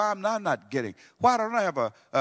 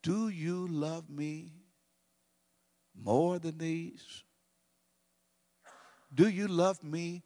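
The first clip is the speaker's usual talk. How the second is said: gentle searching question; 3 semitones higher, slower than usual. Acted. Jesus asking Peter after the denial, slow and gentle. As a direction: ask it slowly, from the heart. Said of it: "Jesus asked Peter the question".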